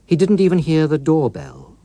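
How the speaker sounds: plain reading voice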